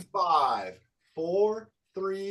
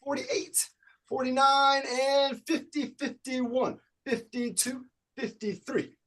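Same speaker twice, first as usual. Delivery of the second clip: high, energetic call of the count; upbeat workout instructor counting reps out loud; fitness coach counting; 9 semitones higher, 6 dB louder than usual. Acted. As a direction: pump the class up as you count each rep. Leads a jumping jack count.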